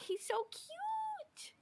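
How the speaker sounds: high, slow cooing gush. Real, not acted. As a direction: coo over something adorable. Gushing over frog logo.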